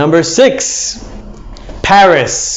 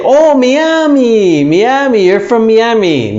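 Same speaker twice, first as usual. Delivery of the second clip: higher, eager "Oh, Miami"; mock impressed people; 6 semitones higher than usual. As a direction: imitate excited people hearing Miami. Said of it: "Many people say".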